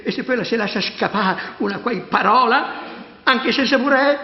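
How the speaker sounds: panicky. Acted. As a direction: panic at the thought. Priest panics about secret.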